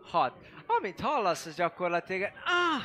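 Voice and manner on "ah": high-pitched moan, imitating a woman; női nyögés. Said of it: "ez a női nyögés". (magas hangú női nyögés utánzása)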